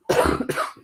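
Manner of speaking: a dry, hacking cough. Real, not acted. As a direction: cough hard. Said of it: "I just have a bad cough".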